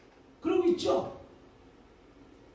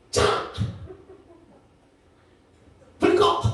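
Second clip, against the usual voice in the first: higher, louder barked order; mock bedtime command; 7 semitones higher, 5 dB louder than usual. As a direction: bark it like an annoyed mom at night. Mother orders child to sleep.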